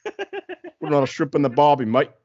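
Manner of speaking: high, hammed-up Aussie; Australian accent. Co-host showing off his Australian accent to friends, hammed-up Aussie. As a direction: do a stock Australian-accent catchphrase. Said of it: "Is that your Australian accent".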